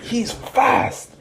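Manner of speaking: high-pitched amazed outburst; acted-out disbelief; startled exclamation. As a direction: blurt it out, astonished, pitch jumping up. Retold fighter amazed at opponent.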